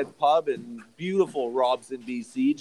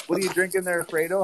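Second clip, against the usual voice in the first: usual speaking voice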